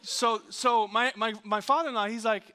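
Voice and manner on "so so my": usual speaking voice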